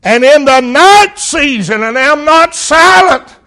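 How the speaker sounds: psalm cried out in anguish; pleading cry of Jesus. Acted. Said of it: "He said in verse two".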